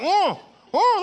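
high excited "Oh, oh" through a snorkel; excited yelp. Retold or imitated. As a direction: squeal it out, pointing at fish. Excited snorkel sounds to wife.

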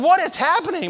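high, loud bewildered cry; shocked. Retold or imitated. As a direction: shout it in disbelief at the stingray. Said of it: "I'm like".

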